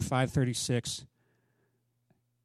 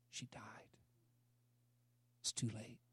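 hushed repetition; 6 semitones lower, 19 dB quieter than usual. Acted. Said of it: "repeated softly".

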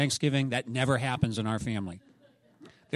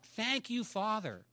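soft grateful prayer; 7 semitones higher, 8 dB quieter, slower than usual. Retold or imitated. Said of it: "I'm like".

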